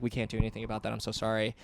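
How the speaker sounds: soft, low-key brush-off; retold helpless apology; apologetic refusal. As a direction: turn them away quietly and apologetically. Staff turning him away apologetically.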